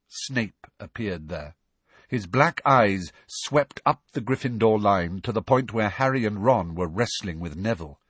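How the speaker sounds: usual speaking voice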